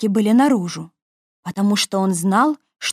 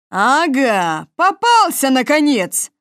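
triumphant; 3 semitones higher, 3 dB louder, slower than usual. Acted. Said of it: "сказал братец-лис".

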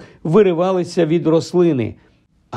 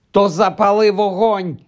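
higher-pitched, urgent cry; shouted order; 6 semitones higher than usual. A narrator's reading. Harry telling Hermione to light a fire, shouting. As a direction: urge her with a restrained yell. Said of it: "закричав Гаррі".

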